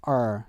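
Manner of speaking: "er" held with American R colour; American R sound. Shown as a demonstration. Teacher isolates American ending sound, R-coloured er. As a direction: curl the R into er, American style. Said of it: "R 색채가 있는 일반 미국".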